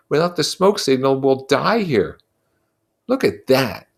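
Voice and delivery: earnest anxious pleading, lightly voiced; Ralph, worried. Ralph confides his fear.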